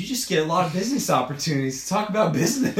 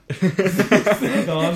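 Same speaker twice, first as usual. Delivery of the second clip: drawn-out, higher mic test with a laugh; slow mock mic check; 4 semitones higher, 5 dB louder, slower than usual. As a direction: stretch it out like a nervous host testing the mic. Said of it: "and it just goes".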